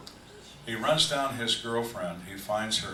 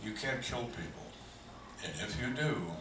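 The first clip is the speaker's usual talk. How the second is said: normal talking voice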